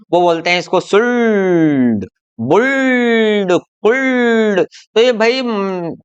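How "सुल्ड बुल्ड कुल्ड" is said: सुल्ड, बुल्ड, कुल्ड with a long, drawn-out L before D; mistaken L sounded. Retold or imitated. Teacher imitates children misreading English books, stretching the L in सुल्ड, बुल्ड, कुल्ड. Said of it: "बच्चे हैं बहुत ज्यादा गलती करते हैं".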